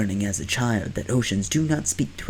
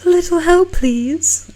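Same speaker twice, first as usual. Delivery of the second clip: much higher, louder sweet call; high girl voice; 8 dB louder than usual. Acted. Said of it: "A voice calls out from behind you".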